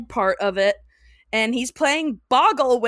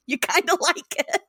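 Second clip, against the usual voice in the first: laughing; 13 semitones higher than usual. Real, not acted. Giggling at own confession.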